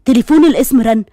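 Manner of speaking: usual speaking voice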